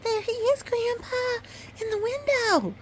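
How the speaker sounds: put-on high, eager little girl; excited cry. A narrator's reading. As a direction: call out with delight. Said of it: "cried Melanie".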